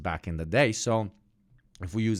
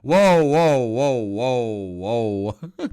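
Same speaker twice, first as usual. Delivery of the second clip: lightly voiced mock alarm; hint of a panicked refugee; imitating startled Cypriots; 2 semitones higher, 7 dB louder, much slower than usual. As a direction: play the startled expats crying whoa. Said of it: "be like".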